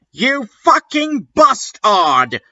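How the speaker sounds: high hammed-up curse; mock yell. Draco curses Vampire in a high mock yell. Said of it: "yelled Draco at Vampire".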